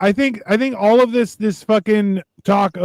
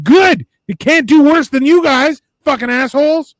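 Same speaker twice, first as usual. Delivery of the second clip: loud, high outburst at the doomsayers; angry rant; 8 semitones higher, 4 dB louder than usual. Real, not acted. Ranting at people fearing a spaceship, angry and loud. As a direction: shout it down at them, fed up.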